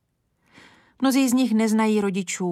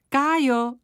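higher-pitched call of a name; 6 semitones higher than usual. A narrator's reading. Teacher's daughter to the boy Kája, calling out. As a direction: call the boy over, voice lifted. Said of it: "zavolala slečna řídících".

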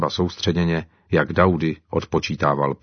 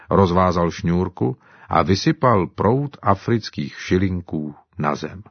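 usual speaking voice

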